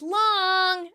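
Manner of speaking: high, drawn-out mock shout; Chandler shouting. Chandler calling his own ball out, shouted. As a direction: imitate a court call, voice way up. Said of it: "Chandler shouts".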